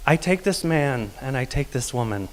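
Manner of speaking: plain reading voice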